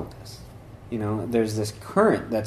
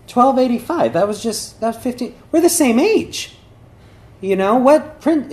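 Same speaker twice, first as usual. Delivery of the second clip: high, eager rush of discovery; a prince thrilled to find a match; excited prince; 12 semitones higher, 5 dB louder than usual. Retold or imitated. Prince reading the portrait's date, high and eager. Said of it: "then he gets all excited".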